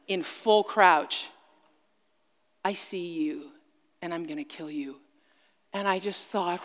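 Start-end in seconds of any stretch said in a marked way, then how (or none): 2.6-5.0 low, hushed predatory threat; a dark, quiet promise from the crouching lion; much softer, low and deadly calm; menacing. Retold or imitated. Voicing the stalking mountain lion.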